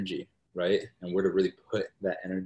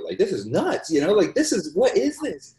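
retold amazement, rising higher and louder; incredulous; 11 semitones higher, 6 dB louder than usual. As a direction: climb into a high, loud squeal of disbelief. Retells his amazed outburst to friend.

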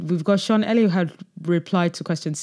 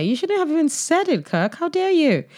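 high-pitched playful telling-off; mock outrage; 11 semitones higher than usual. Host scolding Kirk for even saying it, high mock outrage. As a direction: scold in a high, put-on indignant voice.

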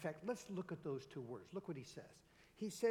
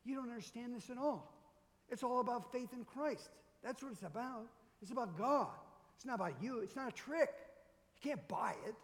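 a retold rebuke, mildly heated; ticked-off Peter; 6 semitones higher than usual. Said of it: "he's all ticked off at him".

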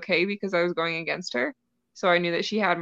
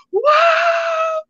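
high, loud thrilled yell; a loud joyful wow; excited shout; 7 dB louder than usual. Retold or imitated. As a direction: burst out with delight. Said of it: "I was so excited".

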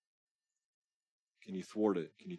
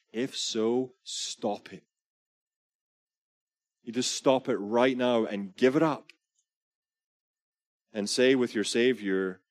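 louder, urgent preaching push; preaching at full force; 3 semitones higher, 7 dB louder than usual. Acted. Preacher challenging believers, louder and urgent. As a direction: drive the challenge home to the congregation.

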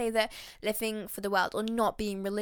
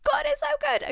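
much higher, bursting with delight; excited; 3 dB louder than usual. Real, not acted. Host too excited to sit still, high joyful burst. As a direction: squeal it out with sheer joy. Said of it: "'cause I'm getting too excited".

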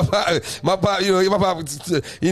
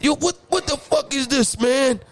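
snapping; 6 semitones higher, slower than usual. Retold or imitated. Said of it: "He, he snapped on me".

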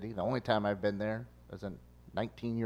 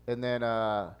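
ordinary delivery

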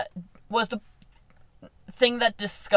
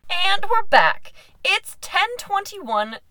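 slow announcer; 9 semitones higher, much slower than usual. Pretend. Hesitant announcer re-intro.

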